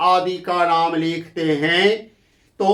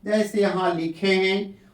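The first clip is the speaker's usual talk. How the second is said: ordinary talk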